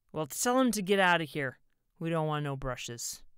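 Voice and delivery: loud, belligerent dismissal; sneering. A narrator's reading. Drunk husband to wife about the salesman, loudly sneering. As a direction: order him out, starting loud and easing off. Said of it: "Art sneered".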